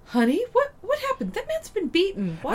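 high-pitched, alarmed mother voice; imitated shocked mom. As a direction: play a mom walking in on trouble. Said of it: "his mom walks in and goes".

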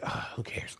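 whispered, fed-up aside; weary dismissal. Retold or imitated. Host reacts to the girl's boast, whispered indifference. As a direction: sigh it out without voice.

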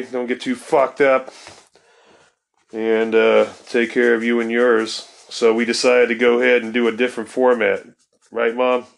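usual speaking voice

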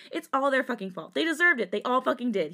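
high, loud, angry outburst; sudden screaming. A narrator's reading. Jisung blames those he hurt, screaming. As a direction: raise it to an acted near-scream. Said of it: "he suddenly screamed".

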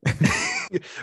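Watto cackle. Retold or imitated. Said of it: "I can do a Watto impression".

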